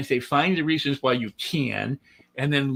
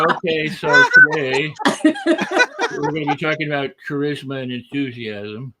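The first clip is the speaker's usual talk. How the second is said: deadpan low-energy talk; 4 dB louder, slower than usual. Retold or imitated. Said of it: "And I go".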